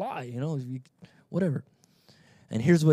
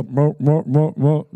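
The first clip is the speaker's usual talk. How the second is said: shepherd's goat call; 2 semitones higher, 7 dB louder, slower than usual. Retold or imitated. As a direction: imitate a gruff call in short repeats. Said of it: "one sound for the goats".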